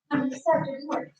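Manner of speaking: from another room. Real, not acted. Walked off to get microphone.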